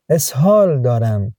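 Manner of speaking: ordinary delivery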